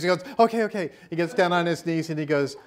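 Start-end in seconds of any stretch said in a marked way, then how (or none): 0.4-0.9 high, loud hurried okays; hasty agreement. Retold or imitated. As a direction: give in fast, voice jumping up. Roger hastily agreeing, retold.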